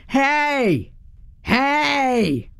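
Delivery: high drawn-out "Hey!", a contained yell; growly yell. A narrator's reading. Woman yelling to scare off coyotes from the porch, growly yell. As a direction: call out a long, rising-pitched warning holler. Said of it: "in my deepest, growliest voice".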